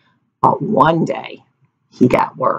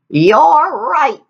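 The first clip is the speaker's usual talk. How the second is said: put-on old woman; 9 semitones higher, 4 dB louder than usual. A narrator's reading.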